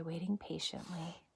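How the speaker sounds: hushed whisper for the shy penguin. Acted. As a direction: breathe it out so nobody startles. Shy penguin, quiet storytime moment.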